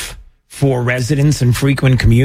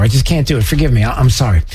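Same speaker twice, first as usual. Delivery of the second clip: usual speaking voice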